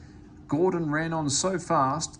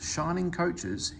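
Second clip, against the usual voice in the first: usual speaking voice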